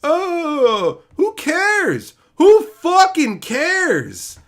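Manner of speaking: high, loud exasperated yell; exasperated outburst. Real, not acted. Ranting to viewers about the story, loud exasperated yell. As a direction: throw up your hands in disgust.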